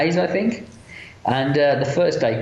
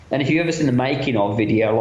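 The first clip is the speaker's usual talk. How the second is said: ordinary talk, nothing marked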